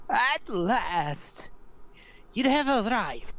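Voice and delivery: put-on high, crackling old crone voice. Seer cackling a welcome to the arriving party. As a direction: crackle it out like dry weeds. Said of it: "her voice crackles like dry weeds".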